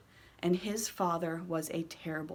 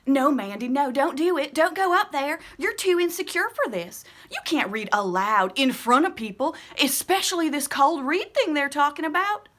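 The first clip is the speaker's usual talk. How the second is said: much higher, louder pleading; panicked inner voice; 8 semitones higher, 8 dB louder than usual. Acted. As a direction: beg yourself not to go up.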